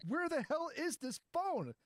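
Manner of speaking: frantic. Retold or imitated. Panicked search for lost phone.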